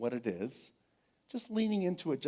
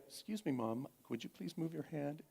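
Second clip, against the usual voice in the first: much softer, carefully polite plea; forced politeness; 2 semitones higher, 9 dB quieter than usual. Retold or imitated. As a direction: imitate a daughter swallowing her rage with manners. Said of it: "being polite at the same time".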